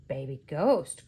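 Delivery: low-pitched, matter-of-fact correction; correcting. A narrator's reading. Ghost correcting the museum man, low and matter-of-fact. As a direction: put him right in a low voice. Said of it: "corrected Gus".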